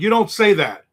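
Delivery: high, loud scolding mom. Retold or imitated. Mother scolding her son for swearing, high loud. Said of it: "She's like".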